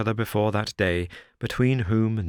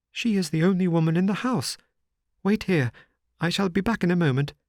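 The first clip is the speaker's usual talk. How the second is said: higher, lightly voiced nervous little man; Sholto's voice; 10 semitones higher, faster than usual. A narrator's reading. Sholto's voice in the story.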